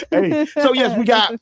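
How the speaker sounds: ordinary delivery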